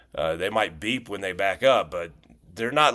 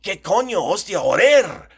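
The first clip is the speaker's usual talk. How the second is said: high, loud burst of Spain curses, one after another; belted string of curses; 10 semitones higher, 7 dB louder than usual. Retold or imitated. Teacher shows how Spaniards belt out several curses in one sentence, loud. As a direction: belt out all three curses at once. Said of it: "belt out several of those in the same sentence".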